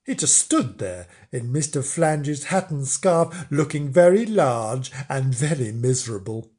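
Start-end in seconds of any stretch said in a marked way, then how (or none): none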